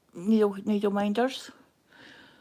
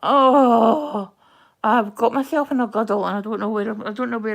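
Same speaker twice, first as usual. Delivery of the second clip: flustered; 11 dB louder than usual. Real, not acted. Flustered at losing her thread.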